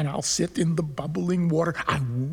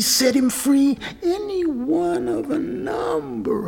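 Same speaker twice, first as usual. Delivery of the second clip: building excitement; 10 semitones higher than usual. A narrator's reading. Building retelling of the fish's offer.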